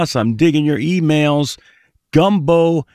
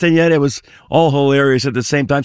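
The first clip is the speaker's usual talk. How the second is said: usual speaking voice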